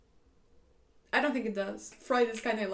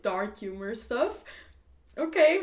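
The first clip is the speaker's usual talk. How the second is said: ordinary talk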